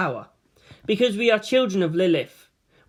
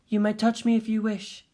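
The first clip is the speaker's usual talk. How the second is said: a hint of a higher, even voice; calm Rei; 5 semitones higher than usual. Rei inviting Shinji closer, calm and plain. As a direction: offer it simply, without emotion.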